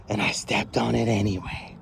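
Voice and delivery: unvoiced, slow whisper. Real, not acted. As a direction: whisper it sheepishly. Whispered rueful admission.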